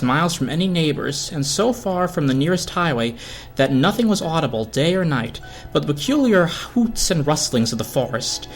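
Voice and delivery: usual speaking voice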